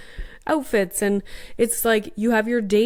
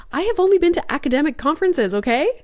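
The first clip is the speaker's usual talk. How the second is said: higher, faster, louder insistence; emphatic protest; 7 semitones higher, 4 dB louder, faster than usual. Real, not acted. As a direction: protest it, half laughing. Exasperated insistence.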